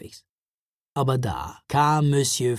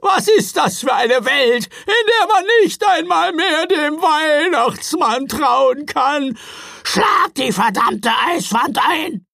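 much higher, louder furious troll ranting; furious yelling; 10 semitones higher, 6 dB louder, slower than usual. A narrator's reading. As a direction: rage at the ice wall like a betrayed troll mother. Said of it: "Mutter Troll war außer sich".

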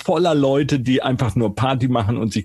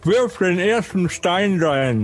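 cork in mouth; 5 semitones higher, slower than usual. Shown as a demonstration. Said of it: "du darfst noch einen trinken".

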